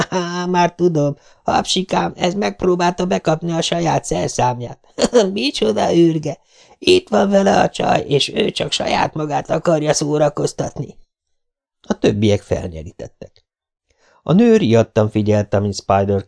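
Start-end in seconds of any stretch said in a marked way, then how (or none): 0.1-11.0 mock him for the whole gang to hear; jeering punk. Greasy-haired punk jeering at naked Spider for his gang, gloating.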